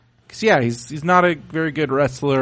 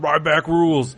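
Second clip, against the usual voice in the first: Ryback catchphrase. Retold or imitated. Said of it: "He goes around".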